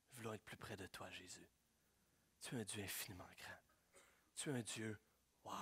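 soft, intimate prayer to Jesus; a softly spoken worship prayer; hushed prayer. Acted. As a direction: pray it quietly, as if face to face.

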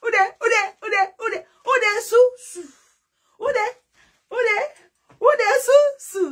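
high wailing cry of alarm; mock wailing call. Mocking the panic with a high wail. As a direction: wail it out like a mourner.

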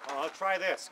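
ordinary delivery

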